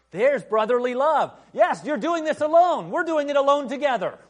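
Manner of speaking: high, loud, fervent; excited preaching. Real, not acted. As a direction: lift the room with joy.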